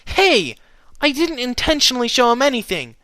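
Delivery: high, loud indignant protest; miffed denial. A narrator's reading. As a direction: deny it, suddenly put out. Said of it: "suddenly sounding a little miffed".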